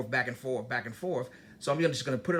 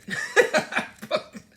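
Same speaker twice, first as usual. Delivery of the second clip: breathy high-pitched laugh; burst of high laughter; 14 semitones higher, 7 dB louder than usual. Real, not acted. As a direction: laugh at the irony. Laughs after Barry got fired.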